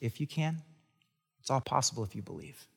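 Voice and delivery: Jesus reassuring the father, soft and playful; gentle wink. Acted. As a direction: say it with a knowing wink, very softly. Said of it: "Jesus maybe gives a little wink".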